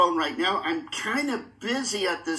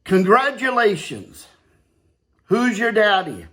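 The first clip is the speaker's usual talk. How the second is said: loud, deliberate award speech; ceremonial speech; 8 dB louder, slower than usual. Acted. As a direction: present the trophy grandly. Formal congratulation speech.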